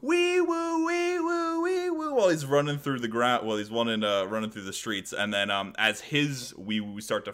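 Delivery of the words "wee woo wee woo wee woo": high wee woo siren voice; high playful vocal siren; siren noise. Retold or imitated. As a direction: wail it like a toy police siren. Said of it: "making a police siren noise".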